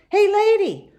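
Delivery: high, loud call after someone. Acted. Girl calling after the old woman, loud call. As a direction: shout to catch her attention.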